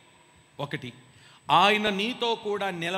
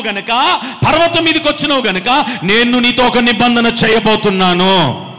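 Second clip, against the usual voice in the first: high, loud, slow proclamation; God promising Moses a covenant, a hint of grandeur; 5 semitones higher, 7 dB louder than usual. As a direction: speak as God making a covenant.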